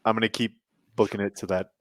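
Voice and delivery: ordinary delivery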